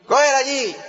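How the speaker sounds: dicho más agudo, sin importarle; tirándola sin pensarlo; despreocupado. Retold or imitated. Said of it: "uno dice". (tono agudo y desentendido)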